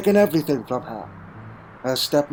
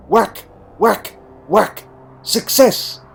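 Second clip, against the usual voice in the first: higher and louder, each whack punched out; excited triumph; 6 semitones higher, 6 dB louder, slower than usual. A narrator's reading. The Doctor hacking at the cable until it breaks: excited, loud triumph. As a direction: hammer out each whack, then cry out in triumph.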